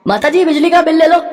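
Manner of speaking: bill collector calling out. Acted. Bill collector calling the old woman at the door, high and loud. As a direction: call through the door like a young deliveryman.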